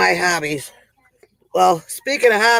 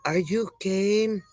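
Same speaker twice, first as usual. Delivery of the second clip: uncle's line; 7 dB quieter, slower than usual. Retold or imitated. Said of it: "And then he goes".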